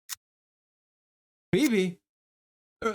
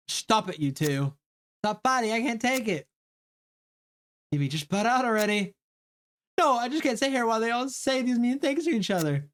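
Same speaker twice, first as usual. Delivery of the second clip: higher, upset plea to stop the fight; pleading peacemaker; 7 semitones higher than usual. Acted. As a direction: beg the two of them to quit. Evie begs them to stop fighting.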